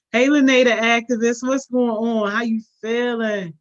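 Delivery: welcoming Lene the activist to the live, called out loud; loud greeting. Real, not acted. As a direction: hail her across the room. Excitedly greeting viewer Lene.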